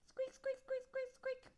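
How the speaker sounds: high squeaky mouse noises; mouse squeaks. Pretend. Reader imitates the skeleton mouse, high squeaks.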